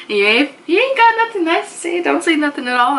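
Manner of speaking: quick high mock lecture voice; mock scolding elder. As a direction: rattle off the old saying like a nagging auntie. Quoting a saying in voice.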